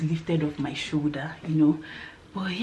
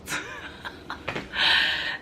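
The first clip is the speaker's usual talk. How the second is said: laugh; 4 dB quieter than usual. Real, not acted. Laughing after telling she passed.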